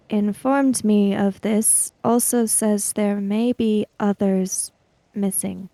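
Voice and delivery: ordinary talk, nothing marked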